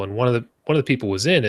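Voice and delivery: ordinary talk, nothing marked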